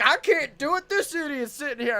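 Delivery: high, loud mock whine; complaining old lady. Old lady stuck behind stalled car, mock exasperated complaint. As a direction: imitate a fed-up old driver.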